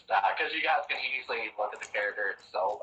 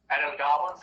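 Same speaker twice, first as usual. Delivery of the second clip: usual speaking voice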